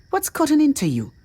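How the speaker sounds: high, snappish challenge; snapped back. A narrator's reading. Woman confronts a wild-eyed companion, snapping back. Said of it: "Asala snapped back".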